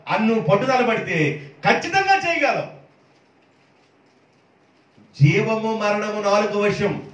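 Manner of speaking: building sermon on God's power; preacher building to a climax; preaching. Acted. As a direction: proclaim each line like a rallying cry.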